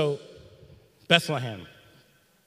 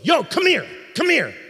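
loud, much higher call; coach's shout; 11 semitones higher, 8 dB louder than usual. Retold or imitated. Lacrosse coach calling his talented player over, shouting.

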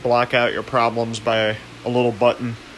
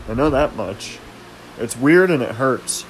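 ordinary delivery

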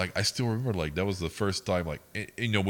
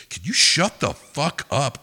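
higher, louder, drawn-out mock outburst; retold angry yell; 7 semitones higher, 10 dB louder, slower than usual. Drive-thru girl yelling at customers, retold angry yell. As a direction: imitate her fed-up yell, stretching it out.

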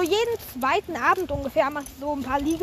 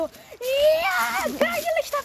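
put-on high, thrilled kids' cheer; excited cheering; 9 semitones higher, slower than usual. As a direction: cheer it like an eager class. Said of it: "Und wir alle waren so".

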